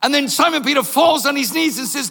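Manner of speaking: usual speaking voice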